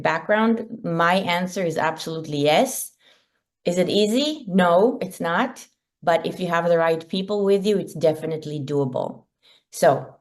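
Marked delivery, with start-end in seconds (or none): none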